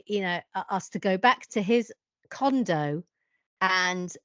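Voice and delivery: ordinary talk, nothing marked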